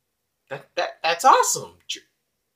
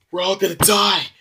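panicked wail; 6 semitones higher, 5 dB louder than usual. Acted. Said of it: "you might wanna calm down there".

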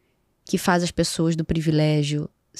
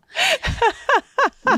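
high laugh. Real, not acted. Risada alta.

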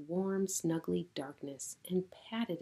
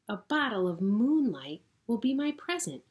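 1 delighted plan; 5 semitones higher, 6 dB louder than usual. Acted. Hedgehog delighted.

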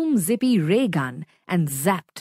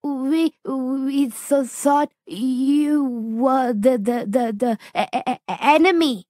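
frightened stutter, stretching then tumbling out; stammering; 4 semitones higher than usual. Acted. Zap stammering an excuse to the monster, frightened stutter. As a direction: stammer in fear. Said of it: "stammered Zap".

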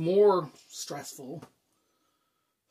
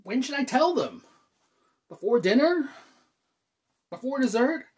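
high, anxious questions; fretting; 7 semitones higher, 4 dB louder than usual. Acted. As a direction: fire off worried options, pitched up. Frets over when to tell parents.

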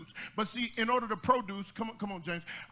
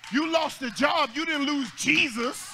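full-throated gospel preaching; preaching at full force; 4 semitones higher than usual. Real, not acted. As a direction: build it like a Sunday climax. Preaching climax.

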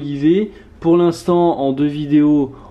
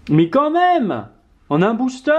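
delighted exclaim; 9 semitones higher than usual. Real, not acted. Delighted at two V cards.

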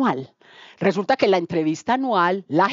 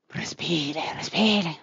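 soft whispered calming; breathy reassuring hush; soothing whisper; 3 semitones lower, 6 dB quieter, much slower than usual. Retold or imitated. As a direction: hush her gently to breathe. Calming the coworker.

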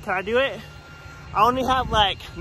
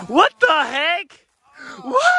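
high, loud, slow "what the heck"; shocked outburst as he sees the fall; startled shock; 10 semitones higher, 8 dB louder, much slower than usual. Real, not acted. Boy seeing his brother fall on the trail, shocked outburst.

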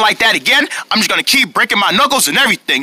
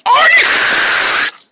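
very high, drawn-out scream; exasperated yell. Acted. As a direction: scream the name at someone who keeps mishearing. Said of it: "I said".

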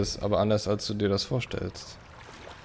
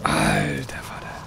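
slow whispered wow; whispered awe; 3 semitones higher, slower than usual. Real, not acted. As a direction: breathe it out in awe. Awed at game scene.

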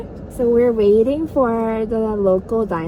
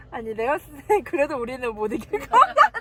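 ordinary delivery

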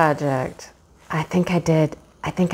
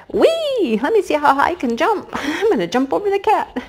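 put-on much higher, fast, giddy baby-animal voice with giggles; jumping lamb voice; 9 semitones higher than usual. A lamb in the picture boasting about its jump, giddy high voice. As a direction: play a gleeful little lamb showing off. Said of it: "He's like".

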